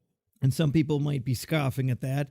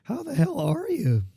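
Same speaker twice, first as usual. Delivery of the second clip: high, mock catchphrase greeting; imitating the Hammer's catchphrase; 9 semitones higher than usual. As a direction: steal his line in his voice. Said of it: "Hey, no, that's my line".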